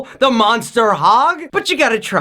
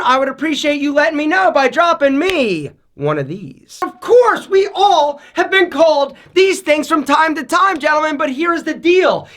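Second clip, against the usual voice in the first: normal talking voice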